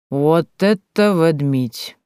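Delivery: slow, drawn-out amazement; lingering drawl of wonder. A narrator's reading. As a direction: stretch every word out. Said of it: "протянула Чебанцева".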